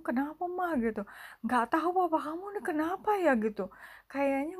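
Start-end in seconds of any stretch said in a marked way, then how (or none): 0.0-0.7 higher-pitched mock bewilderment; confused question. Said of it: "kita semua juga bingung". 1.5-3.3 imitated puzzlement, pitched well up; confused mother. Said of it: "mama saya juga bingung".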